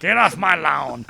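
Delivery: mock old-timer hollering at people; cranky old man. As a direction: yell it like a grumpy old man. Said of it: "I'm the old man of the podcast".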